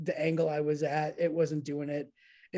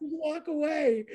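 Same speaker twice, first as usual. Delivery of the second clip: insistent order; 10 semitones higher, slower than usual. Retold or imitated. Repeated command.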